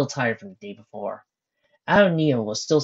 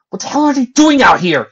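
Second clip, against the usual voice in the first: much higher, louder shout; 13 semitones higher, 13 dB louder than usual. Acted. Carter shouting at Lucy in shock, loud. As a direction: yell it at her in shock. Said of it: "he shouted at her".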